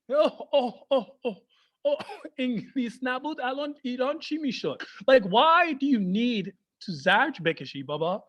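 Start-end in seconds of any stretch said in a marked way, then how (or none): none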